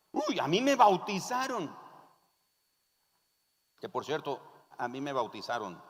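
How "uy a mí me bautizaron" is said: lightly acted boast with a higher pitch; boastful believer. Nominal believer defending that they know God, lightly boastful. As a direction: give a little "uy" of self-assurance.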